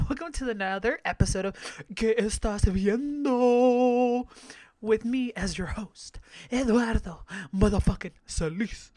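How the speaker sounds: announcer-style show welcome; podcast host greeting; host intro. Acted.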